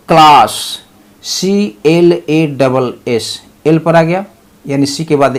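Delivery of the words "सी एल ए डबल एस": each letter spelled slowly and clearly; careful slow spelling. Shown as a demonstration. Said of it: "spelling out letters".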